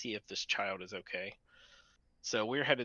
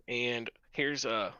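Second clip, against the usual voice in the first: ordinary talk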